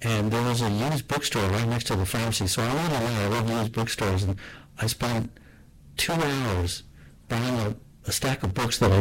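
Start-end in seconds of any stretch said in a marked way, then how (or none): none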